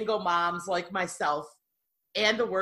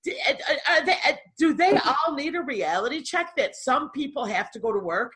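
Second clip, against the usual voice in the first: exasperated outburst; 8 semitones higher than usual. Retold or imitated. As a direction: retell the outburst with rising alarm. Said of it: "And I'm like".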